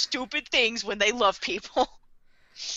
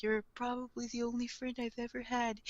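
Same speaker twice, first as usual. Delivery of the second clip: soft, subdued wistful confession; longing and mournful; 12 dB quieter than usual. Acted. L confesses to his only friend, mournful. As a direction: say it quietly, with muted longing. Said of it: "longing and mournful".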